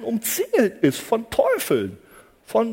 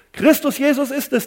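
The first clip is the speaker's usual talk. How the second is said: emphatic repeat; 5 semitones higher, 5 dB louder than usual. Acted.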